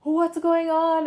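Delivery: high, loud, slow panicked cry at a bleeding nose. Retold or imitated. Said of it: "I'm like".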